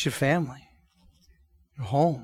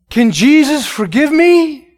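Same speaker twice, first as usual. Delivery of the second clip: pleading question; 9 semitones higher, 5 dB louder, slower than usual. Retold or imitated. Deaf man asking, weeping.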